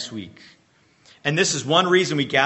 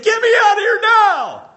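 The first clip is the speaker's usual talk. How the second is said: panicked high shout, much higher and louder; 10 dB louder than usual. Pretend. Pastor voicing a terrified David begging to escape, panicked shout. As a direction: yell it like a man desperate to escape the valley. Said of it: "David would be freaking out".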